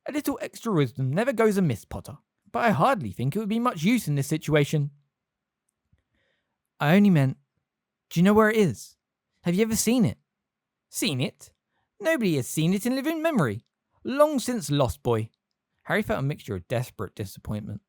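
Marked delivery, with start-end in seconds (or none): 0.1-4.9 high-pitched little wizard; squeaky professor. A narrator's reading. Flitwick speaking, high voice. 6.8-10.2 urgent. A narrator's reading. Harry pressing urgently. 10.9-15.3 high-pitched little wizard; squeaky professor. A narrator's reading. Flitwick speaking, high voice.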